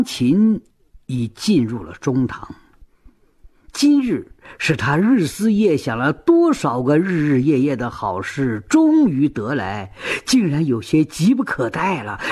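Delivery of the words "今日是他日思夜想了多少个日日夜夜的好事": ordinary delivery, nothing marked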